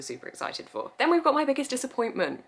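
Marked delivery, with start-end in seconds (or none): 0.0-0.9 whisper. Real, not acted. Trailing whisper about sequels.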